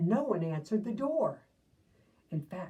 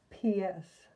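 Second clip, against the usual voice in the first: ordinary delivery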